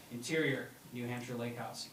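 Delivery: ordinary talk, nothing marked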